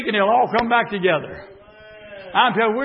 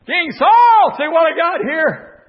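calling out; 9 semitones higher, 9 dB louder, slower than usual. Acted. David calling out to Saul.

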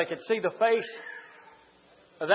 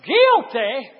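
loud, high-pitched hellfire sermon; thundering pulpit shout; full-force preaching; 10 semitones higher, 9 dB louder, much slower than usual. Acted. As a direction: preach it at the top of your voice.

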